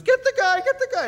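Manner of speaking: very high, loud shriek; screaming. Retold or imitated. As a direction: scream for the lifeguard to come. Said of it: "She goes".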